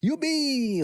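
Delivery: high cheering whoop; all the kids cheering; shouting. A narrator's reading. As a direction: whoop for joy. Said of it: "råbte alle".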